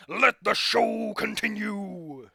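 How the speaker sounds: loud, high, slow hype shout; showman announcer. Acted. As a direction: bellow it like a ringmaster. Announces the show to crowd.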